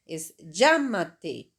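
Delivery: llámate said slowly, LLÁ leaned on; stress on LLÁ. Shown as a demonstration. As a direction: drag out llámate, hitting LLÁ. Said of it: "Está aquí el acento".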